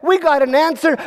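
high-pitched excited announcement. Acted. Advisors finding they agree on a new king, excited. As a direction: burst out with the discovery. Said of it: "they got all excited".

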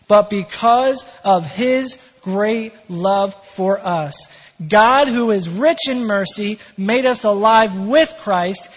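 slow, emphatic reading with weighty pauses; firm, drawn-out scripture delivery; measured scripture reading. Real, not acted. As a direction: read the verse out loud and deliberately, word by word. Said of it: "read that again with maybe a little bit more excitement".

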